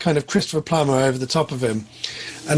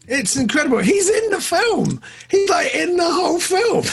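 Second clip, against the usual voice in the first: real astonishment, pitched way up; amazed exclamation; 13 semitones higher than usual. Real, not acted. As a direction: exclaim it high, stretching the key words. Amazed at reshot film.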